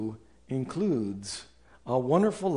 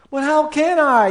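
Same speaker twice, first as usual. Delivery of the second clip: much higher, louder plaintive whine; whiny objection; 11 semitones higher, 7 dB louder than usual. Acted. A listener objecting to the pastor, plaintive whine. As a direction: plead helplessly.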